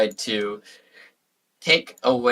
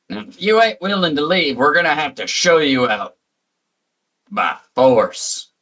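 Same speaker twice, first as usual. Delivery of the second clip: a hint of a threatening southern farmer; southern accent; 4 semitones higher, 4 dB louder than usual. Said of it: "What's with your crummy southern accent?".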